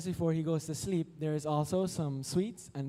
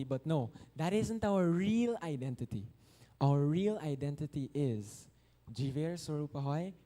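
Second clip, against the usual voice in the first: ordinary talk, nothing marked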